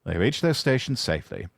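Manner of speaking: ordinary delivery, nothing marked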